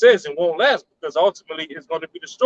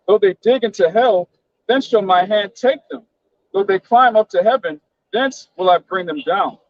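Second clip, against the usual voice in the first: higher-pitched, declaimed Bible verse; scripture reading; 4 semitones higher than usual. Acted. Reading Amos to fellow believers, declaimed scripture reading. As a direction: proclaim the verse phrase by phrase.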